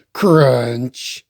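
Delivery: plain reading voice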